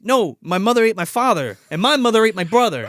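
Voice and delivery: overacted teen distress; mock frantic correction. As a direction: insist on the awful facts. Acting teens' frantic exchange.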